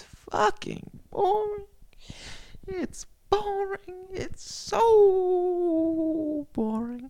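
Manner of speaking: high, slow whining sob; whiny crying. Acted. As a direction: drag it out in a mock cry. Whining about boring topics.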